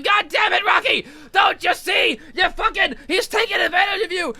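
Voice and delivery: shrill retold yell at Rocky; mock yell. Adrian yelling at Rocky to see he's used, mock shout. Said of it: "Adrian's yelling at him".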